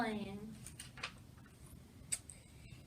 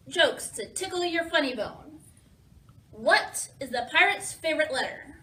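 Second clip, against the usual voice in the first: plain reading voice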